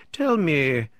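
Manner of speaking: sudden loud, high demand; an impulsive burst, falling at the end; impetuous. A narrator's reading. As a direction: blurt it out impetuously. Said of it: "says impetuously".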